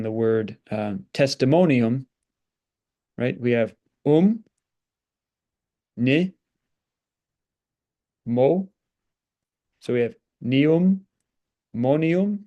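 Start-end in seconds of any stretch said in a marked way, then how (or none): 3.4-12.4 "ni", "mo", "nium", "monium" spelled out slowly; syllable by syllable. Shown as a demonstration. Teacher breaking down a Latin word for students. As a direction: build "testimonium" up from its syllables. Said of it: "we say testimonium".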